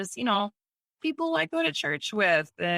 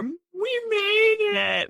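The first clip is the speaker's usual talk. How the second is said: slow, much higher, crying with joy; tearful relief; 5 dB louder, much slower than usual. Retold or imitated. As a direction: squeeze it out through happy tears. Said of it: "I'm just sitting there crying".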